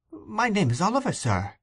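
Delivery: put-on high-pitched astonished small boy. A narrator's reading. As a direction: start high, as a puzzled little invalid. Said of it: "with a look of great astonishment".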